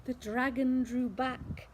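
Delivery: ordinary delivery, nothing marked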